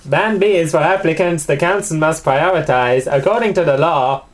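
attempted Mexican accent; amateur, put-on Mexican lilt. Shown as a demonstration. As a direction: read it out in a mock Mexican accent. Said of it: "you have to do Mexican for Band B now".

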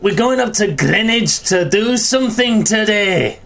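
high, hammed-up Scottish; slow, high-pitched attempt at Scottish; Scottish accent. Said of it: "in a Scottish accent".

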